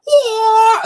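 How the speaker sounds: loud, high, sharp karate cry; squealed karate shout "Wah!"; mock karate yell. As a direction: kick it out with a yell. Imitating a karate kick cry.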